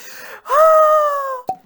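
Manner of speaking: loud, very high drawn-out cry; high dramatic gasp. Acted. Crafter seeing the next pattern, high dramatic cry. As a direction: let out a long, high, hammed-up wail.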